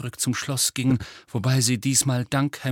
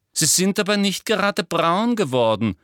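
high, louder put-on voice; Umbridge's pointed remark; 8 semitones higher, 4 dB louder than usual. Umbridge comments on Hagrid's skin, high and pointed. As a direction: needle him, pitched high and forceful.

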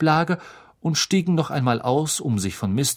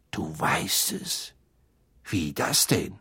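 put-on low, slow, softer older man; puzzled, lowered fatherly voice; Mister Weasley taken aback; 7 semitones lower, 5 dB quieter, slower than usual. A narrator's reading. Mister Weasley learns Harry already knows, slow low puzzlement.

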